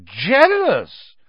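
high, loud, scornful echo; mocking one-word question; incredulous scorn. A narrator's reading. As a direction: throw the word back in disbelief. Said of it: "didn't need to shout any more".

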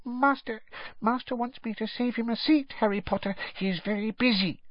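high, small elf voice; dutiful squeaky elf. A narrator's reading. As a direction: explain meekly in a tiny squeak. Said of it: "said Winky".

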